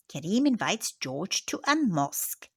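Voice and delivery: plain reading voice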